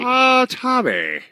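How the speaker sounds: high, drawn-out hello; excited greeting. Real, not acted. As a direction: sing out his name slowly. Greeting Tommy on the phone.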